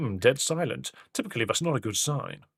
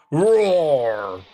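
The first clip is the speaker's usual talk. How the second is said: loud playful roar; 2 semitones higher, 6 dB louder than usual. Acted. The hammer girl announcing herself with a loud roar. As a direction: belt out a big mock roar. Character introduces herself loudly.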